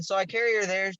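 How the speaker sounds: ordinary delivery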